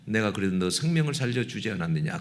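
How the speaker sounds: low, hushed voice of God; God's inner voice. Retold or imitated. God answers the complaining pastor, low quiet inner voice. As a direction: answer softly and deep, as heard within the heart. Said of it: "내 마음속에 딱 들리는 음성이".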